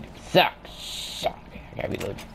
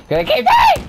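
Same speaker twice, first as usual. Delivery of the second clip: very high, loud shriek; shrieking excited boast; squealing boast; 10 dB louder than usual. Real, not acted. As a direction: scream it with glee.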